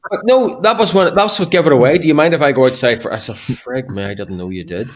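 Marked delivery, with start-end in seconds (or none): none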